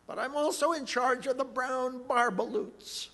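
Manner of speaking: high, loud indignant snap; snapping Lorax. Acted. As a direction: snap at him with outrage. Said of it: "He snapped".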